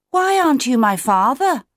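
put-on high young chick's voice; questioning chick. A narrator's reading. Otto to Claudius who found him, high puzzled question.

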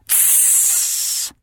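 angry hiss through the teeth; sharp hiss. A narrator's reading. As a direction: hiss him quiet. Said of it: "She hissed".

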